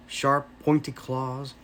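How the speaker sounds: ordinary delivery, nothing marked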